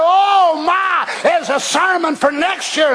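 normal talking voice

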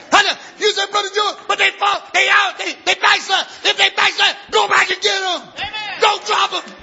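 very high, loud preaching shout; full-force preaching. Real, not acted. Preacher shouting at the congregation, loud and high. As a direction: drive it home to the church.

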